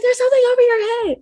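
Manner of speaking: high, fast frightened plea; panicked warning to mom; scared child. Retold or imitated. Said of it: "I'm like".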